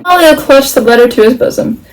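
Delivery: ordinary delivery, nothing marked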